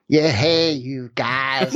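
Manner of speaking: hammy American accent, half-laughing; bad American accent. Shown as a demonstration. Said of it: "I'm just terrible at accents".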